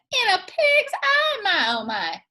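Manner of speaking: high squeaky exclaim on pig's eye, then dropping; a light old-granny cry of disbelief; Granny's exclamation. Acted. Granny scoffing at the talking object, lightly voiced.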